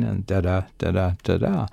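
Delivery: soft da-DA beats in a steady iambic pattern; iambic rhythm. Shown as a demonstration. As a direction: tap out the meter with da-da syllables. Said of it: "And that's one line".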